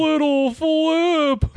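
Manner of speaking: very high, slow drawn-out name; [name] impression. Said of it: "[name] impression".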